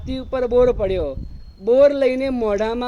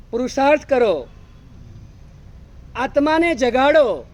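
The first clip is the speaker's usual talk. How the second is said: rousing exhortation; 6 semitones higher, 7 dB louder than usual. Real, not acted. Preacher exhorts congregation.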